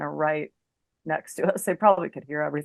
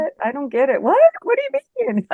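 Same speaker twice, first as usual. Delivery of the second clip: retold bewildered questioning, pitched up; baffled; 7 semitones higher, 6 dB louder than usual. Girlfriend questioning her angry boyfriend's curfew, baffled. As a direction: demand an explanation, in mock bafflement.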